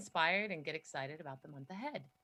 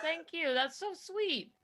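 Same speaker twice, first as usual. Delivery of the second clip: touched; 8 semitones higher, slower than usual. Real, not acted. Thanking for the tribute.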